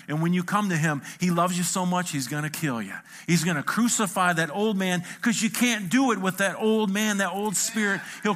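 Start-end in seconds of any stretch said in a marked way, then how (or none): none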